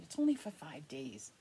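breathy whispered aside; hushed confession. Real, not acted. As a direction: drop to a whisper for the side note. Aside about short trip.

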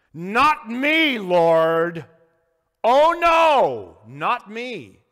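Peter's high, loud cry of protest; loud objection. Acted. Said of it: "and he objected".

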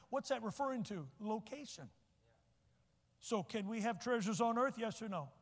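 normal talking voice

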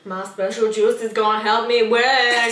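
loud, drawn-out pageant girl impression; put-on pageant kid boast, stretched out; Honey Boo Boo. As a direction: imitate a child beauty queen, slow and loud. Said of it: "What else did she say?".

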